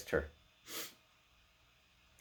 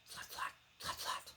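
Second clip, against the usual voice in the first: soft rhythmic hoof sounds, far off; whispered hoofbeats; 11 dB quieter than usual. A narrator's reading. Narrator imitating faraway horse hoofs, whispered. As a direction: whisper the hoofs like distant galloping.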